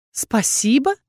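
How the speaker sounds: genuinely can't believe it; sincerely surprised. A narrator's reading. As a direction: witch surprised he expects thanks. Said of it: "искренне удивилась я".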